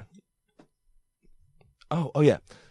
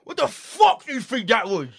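imitated outraged, high-pitched demand; Ray Winston angry; 10 semitones higher than usual. Actor berating a student before everyone, imitated anger. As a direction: tear into a bad performance. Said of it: "he was talking like that".

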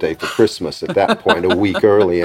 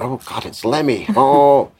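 higher, hushed star-struck reaction; awed young band; 6 semitones higher, slower than usual. Retold or imitated. Young band sees [name] walk in, awed. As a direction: lightly mimic an awestruck young band.

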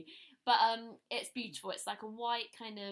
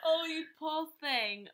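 mock sympathy; 7 semitones higher, 8 dB louder, slower than usual. Sympathising over a shopping ordeal.

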